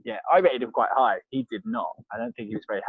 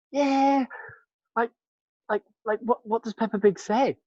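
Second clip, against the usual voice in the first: high, halting, unsure reply; higher-pitched awkward hesitation; retold puzzlement; 10 semitones higher than usual. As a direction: act out being baffled by the request.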